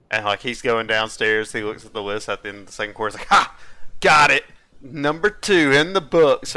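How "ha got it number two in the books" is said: loud, raised triumphant crowing; gloating. Retold or imitated. Host imitating his friend checking the list, mock gloating. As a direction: imitate a cocky kid claiming another win.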